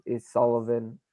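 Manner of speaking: ordinary talk, nothing marked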